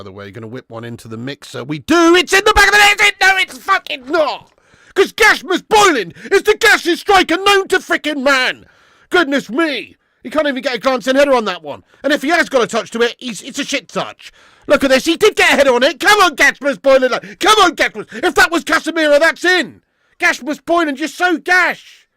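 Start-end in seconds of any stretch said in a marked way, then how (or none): 1.6-3.1 much higher, much louder goal celebration yell; excited shout. Real, not acted. Fan watching the free kick, ecstatic goal shout. 3.2-22.1 much higher and louder angry rant at the striker; furious shout. Real, not acted. Fan raging at his team's striker, furious yelling.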